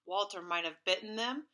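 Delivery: plain reading voice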